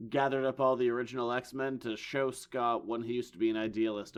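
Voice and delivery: ordinary talk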